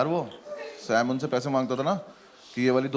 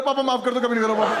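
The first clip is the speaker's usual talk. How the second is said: high, laughing mock plea shouted from the bathroom; mock yell; 8 semitones higher than usual. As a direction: yell a begging apology through a door, laughing. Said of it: "वहीं से चिल्ला रहा".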